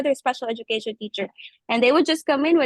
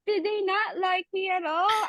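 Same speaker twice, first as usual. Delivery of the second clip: higher, mildly hurt question; hurt wondering; 6 semitones higher than usual. Retold or imitated. New teacher wondering if the students dislike her, mildly hurt. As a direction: retell wondering, a little hurt. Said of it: "hurt because I thought".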